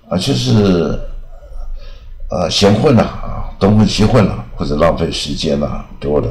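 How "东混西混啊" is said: ordinary talk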